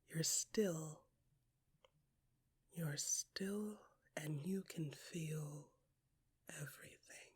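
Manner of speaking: fading into a near-silent whisper; soft whisper fading away; whispered stillness. A narrator's reading. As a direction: let it drift to nothing, hushed and still. Stillness in the ocean.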